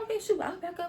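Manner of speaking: mock flustered answer to the shouting lady. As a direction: squeak it out, caught off guard. Her own flustered reply, retold.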